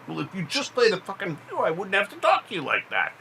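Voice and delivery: high, fast, snapping back; heated family shouting. Acted. Quarrel answer, higher and faster.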